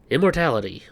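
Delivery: normal talking voice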